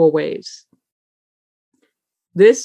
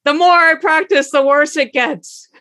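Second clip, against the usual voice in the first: exasperated complaint; 8 semitones higher than usual. Retold or imitated. Student complains to the teacher, in mock exasperation. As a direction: play the frustrated student complaining in a lightly higher voice. Upset student quoted, rising pitch.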